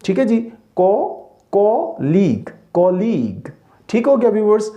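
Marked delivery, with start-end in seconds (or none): 0.8-3.5 "को" alone, then "कलीग" drawn out as ko-leeg; syllable-by-syllable drill. Shown as a demonstration. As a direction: stretch "कलीग" slowly for learners. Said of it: "इसकी सही pronunciation है कलीग".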